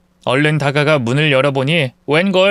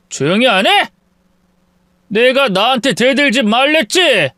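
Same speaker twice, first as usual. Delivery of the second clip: threatening shout; 7 semitones higher than usual. Acted. Husband silencing wife.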